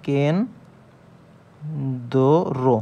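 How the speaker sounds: "কেন, দো, রো" in separate syllables. Shown as a demonstration. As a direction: break it into কেন, pause, দো, রো. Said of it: "দেখেন, কেন". ("কেন, দো, রো" আলাদা আলাদা করে উচ্চারণ)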